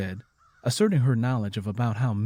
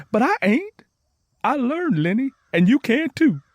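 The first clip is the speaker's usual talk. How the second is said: much higher and louder, insisting; eager encouragement; 13 semitones higher, 7 dB louder than usual. A narrator's reading. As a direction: lift her up with excited conviction. Encouraging friend she can learn.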